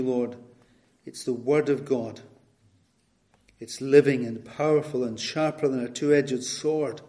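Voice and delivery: usual speaking voice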